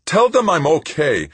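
patient pleading with the nun over the medic. A narrator's reading. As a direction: beg her to back you up. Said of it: "Tavalera pleaded to her".

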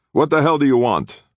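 loud, high-pitched confrontation; angry demand. A narrator's reading. Guard confronts unwelcome visitors at the door, angry demand. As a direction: challenge them hard and loud. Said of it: "he demanded".

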